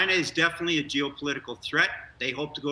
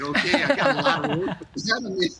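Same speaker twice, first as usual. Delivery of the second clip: laughing; 7 semitones higher, 5 dB louder than usual. Retold or imitated. Retells his own pre-launch thought.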